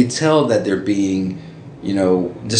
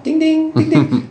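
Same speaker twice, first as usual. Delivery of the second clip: high ringing ding sounds; bell imitation; 3 dB louder than usual. Riding the tricycle at the party, high bell dings. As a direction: ring it out like a little bell. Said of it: "rung a bell".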